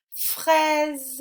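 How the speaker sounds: "Fraise" pitched high and loud on the è; emphasised è sound. Shown as a demonstration. Teacher corrects the exercise, è in "Fraise" emphasised. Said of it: "on entend bien est".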